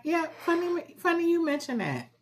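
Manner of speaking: high coy voice. Retold or imitated. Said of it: "I was looking like".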